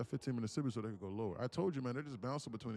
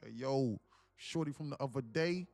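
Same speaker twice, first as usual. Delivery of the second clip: loud, bragging New York homeboy; 3 semitones higher, 6 dB louder than usual. Acted. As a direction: put on a punchy New York accent. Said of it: "Oh, my New York voice".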